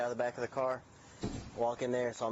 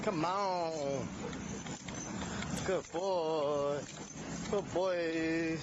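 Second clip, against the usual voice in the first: talking to a dog; 6 semitones higher, slower than usual. Real, not acted. Coaxing a dog to come.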